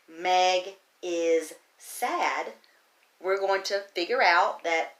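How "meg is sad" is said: Meg is sad drawn out word by word; very slow reading. Shown as a demonstration. Teacher modelling slow reading of a sentence. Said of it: "If we read a sentence like this".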